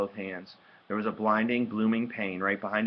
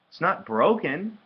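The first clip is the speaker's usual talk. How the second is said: high, slower, lilting reassurance with an edge; dismissive sister; 10 semitones higher, 6 dB louder, slower than usual. A narrator's reading. Sister checking brother's nose, dismissive. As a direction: brush off the injury in a raised pitch.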